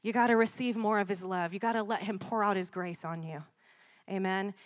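usual speaking voice

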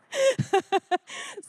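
squealing laugh; high burst of laughter. Real, not acted. Preacher laughing at Billy Madison, high squeal. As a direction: crack up high and loud.

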